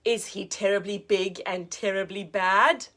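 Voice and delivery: put-on lively read-aloud for children; teacher reading a picture book to a child, lively; storybook narration. A narrator's reading.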